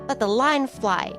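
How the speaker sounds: ordinary delivery, nothing marked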